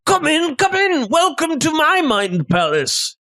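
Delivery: high-pitched chef character greeting a guest; welcoming chef. Acted. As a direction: usher them in grandly, pitched high, slowing at the end. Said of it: "the chef is welcome".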